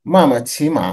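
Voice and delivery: normal talking voice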